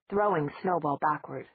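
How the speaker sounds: usual speaking voice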